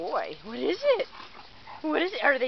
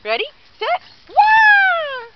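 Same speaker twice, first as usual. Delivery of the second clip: high, loud playful yell to start the game; excited shout; 11 semitones higher, 5 dB louder, slower than usual. Real, not acted. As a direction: launch the toss with a big high shout. Starting dog's fetch game.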